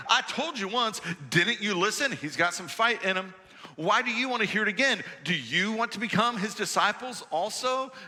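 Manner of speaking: mock them for asking again; sarcastic. Healed man sarcastically fending off the Pharisees. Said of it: "Do you hear the sarcasm?".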